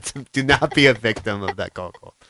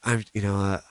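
tired brush-off; 6 semitones lower, 8 dB quieter than usual. Retold or imitated. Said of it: "did you say".

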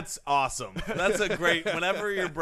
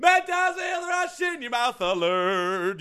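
loud, slow, higher ad shout; shouted slogan; 8 semitones higher, 6 dB louder, slower than usual. Acted. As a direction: bellow the sponsor alert slowly.

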